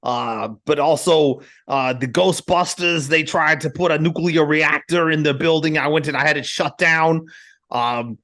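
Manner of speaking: high, pushed self-aggrandizing mayor; mock mayor boasting; Rudy Giuliani. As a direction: brag like a blustering politician. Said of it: "You can do Rudy".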